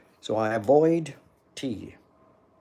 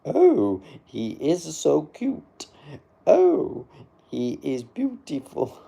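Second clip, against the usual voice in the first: high, drawn-out mock swooning; mock gushing; 7 semitones higher, 8 dB louder, slower than usual. Streamer mimicking an admiring viewer's comments, mock gushing. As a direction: ham up the adoring compliments.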